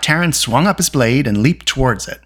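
usual speaking voice

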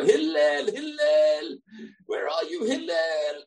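high, drawn-out mock shout; calling out for Hillel. Pest banging on the rabbi's door, high mock calling. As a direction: holler a name through a door, stretched out. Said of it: "Someone starts banging on your door".

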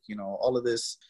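ordinary talk

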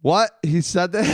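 high, loud disbelief; imitating shocked lawyer. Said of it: "He's like".

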